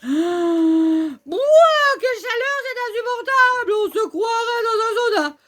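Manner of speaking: high, hammed-up complaint about the heat; overheated gasp. Pokémon character wilting in the fire attack's heat, hammed-up.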